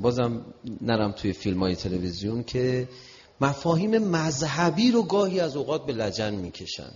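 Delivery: usual speaking voice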